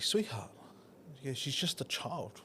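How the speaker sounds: soft, soothing imitation of a wife; softly calm an upset partner, unhurried; gentle voice. Wife excusing the toddler to her husband, gentle soft voice. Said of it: "in her most gentle voice".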